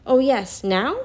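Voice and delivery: excited. A narrator's reading. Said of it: "jumping up and clapping her hands".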